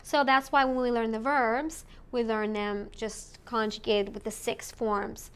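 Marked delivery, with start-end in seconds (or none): none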